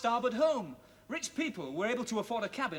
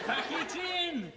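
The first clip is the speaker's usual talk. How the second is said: high, slow, fed-up pack it in; exasperated order; 8 semitones higher, much slower than usual. Acted. Storyteller slowly tells listener to stop chanting. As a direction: stretch it out in exasperation. Narrator stops silly chanting.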